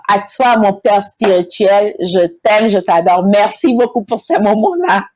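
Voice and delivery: ordinary talk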